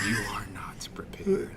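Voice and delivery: eerie whisper; whispered villain line. Retold or imitated. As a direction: hiss it like a demon hunter. Quoting game villain, whispered.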